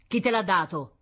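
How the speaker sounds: father pressing for an answer; pressing question. A narrator's reading. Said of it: "incalzò il sor Meletti". (domanda incalzante)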